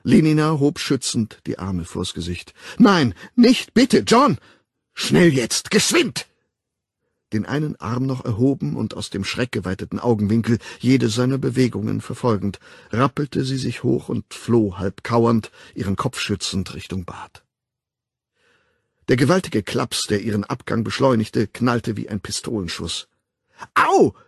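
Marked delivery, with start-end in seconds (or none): 2.8-4.4 high, loud begging; terrified plea. A narrator's reading. Woman shielding her face from the man, terrified plea. 5.0-6.3 raised, urgent order; angry command. A narrator's reading. Raging man chasing the woman out, angry command. Enraged man chases her away. 23.8-24.2 high, loud cry of pain; pained yelp. A narrator's reading. Fleeing woman struck by the man, pained yelp. Slapped, she cries out.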